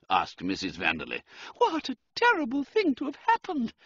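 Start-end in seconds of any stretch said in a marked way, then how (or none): 1.6-3.7 very high female voice; high-pitched society sympathy; gushing society lady. A narrator's reading. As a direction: commiserate in a piercing high voice. Society lady commiserates with Count.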